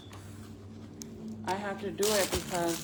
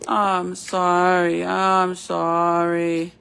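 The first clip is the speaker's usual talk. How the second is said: slow, louder drawn-out sorry; tender apology; 3 semitones lower, 7 dB louder, much slower than usual. Real, not acted. Mom apologizing to her fussing baby, slow and tender. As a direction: soothe him, stretching each word.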